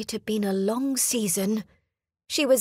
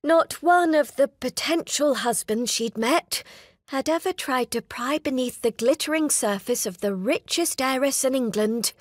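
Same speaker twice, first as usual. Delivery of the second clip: usual speaking voice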